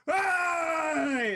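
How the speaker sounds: loud, high drawn-out whoop; crowd cheer. Acted. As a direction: shout it like a whole bar reviving. Bar crowd cheers back to life.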